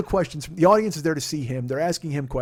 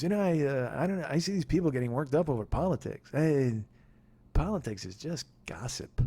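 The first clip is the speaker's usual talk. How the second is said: Norm Macdonald deadpan; 6 dB quieter than usual. Retold or imitated. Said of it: "he just goes".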